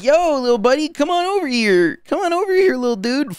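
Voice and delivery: put-on very high, sweet baby-talk coaxing; cutesy lure. Streamer luring a cute little lock to him, squeaky high baby talk. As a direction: coax a tiny creature over in a squeaky high voice. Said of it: "I'm like".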